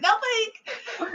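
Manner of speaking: very high, squeaky mock-innocent denial; flustered cover-up. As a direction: squeak it out as if caught. Caught out after slip-up, covers it.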